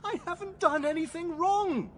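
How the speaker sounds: high, slow, half-laughing half-crying denial; pleading innocence with a nervous break in the voice; distressed protest. Acted. Suspect denies it to the gunwoman, laughing and crying.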